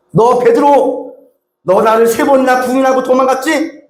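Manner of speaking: pin him down with blame; accusing. Retold or imitated. Jesus as he did not speak to Peter, accusing. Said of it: "이렇게 말씀 안 하세요".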